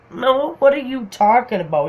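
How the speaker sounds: high-pitched, acted bewilderment; baffled protest. Her answer to his Santa teasing, baffled. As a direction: protest as if totally confused.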